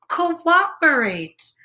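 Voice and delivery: slow, drawn-out Cooperate; teaching a new word, slowly; slow spelled-out word. Shown as a demonstration. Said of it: "what's that word?".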